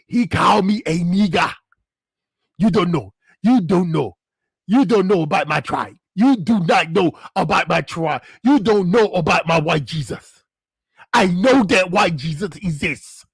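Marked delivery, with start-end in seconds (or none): 0.1-10.4 lightly voiced Nigerian man, lower; a hint of a Nigerian accent, indignant; mock Nigerian man. Playing an offended Nigerian man in a mild Nigerian accent. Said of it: "I can do the dudes better".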